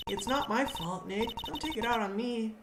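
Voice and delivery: a hint of a high character voice; lightly voiced game sidekick. Voicing Maya protesting to Nick.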